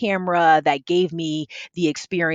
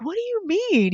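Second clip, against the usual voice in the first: much higher, bewildered question; retold hurt confusion, pitched way up; confused, close to tears; 13 semitones higher than usual. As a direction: ask it high and lost, lip quivering. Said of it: "And I'm like".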